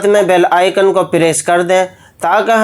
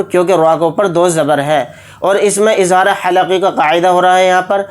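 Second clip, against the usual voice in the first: ordinary delivery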